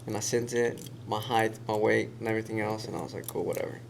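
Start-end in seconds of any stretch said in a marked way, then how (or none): 3.3-3.8 indifferent. Retold or imitated. Shrugging off a fake-looking casting ad.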